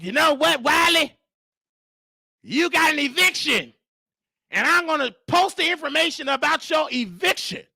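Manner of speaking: retold upset threat. Upset guest threatening to post eviction information, mimicked indignation. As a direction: raise the pitch for an indignant announcement. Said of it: "she's getting upset and said".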